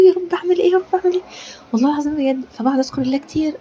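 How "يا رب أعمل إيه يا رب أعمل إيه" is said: higher, lightly acted anxious plea to God. Retelling her Ramadan worry, a mild anxious plea. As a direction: replay the fretful prayer, pitched up. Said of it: "ببقى حاسة إن أنا متوترة".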